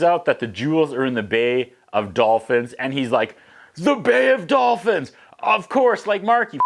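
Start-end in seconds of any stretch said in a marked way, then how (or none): 3.7-6.6 high, slow, over-the-top realization; hammed-up eureka; mock revelation. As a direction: gasp it like a big discovery. Mark voiced realizing.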